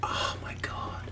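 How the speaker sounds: hushed oh my god; whispered. Real, not acted. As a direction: breathe it out unvoiced. Whispered reaction.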